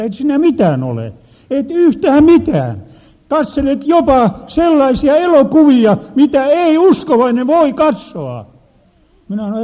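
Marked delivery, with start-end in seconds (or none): 0.0-8.5 high, loud scolding voice of God; God rebuking. Retold or imitated. God scolding the preacher about his films, loud and high. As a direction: thunder down on him as an angry God. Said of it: "Nyt Jumala näytti".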